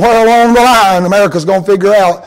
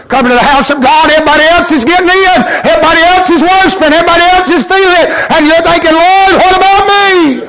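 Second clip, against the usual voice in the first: high, loud, driving preacher's build; preaching at full force; 10 semitones higher, 3 dB louder than usual. Acted. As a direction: climb to a preaching peak.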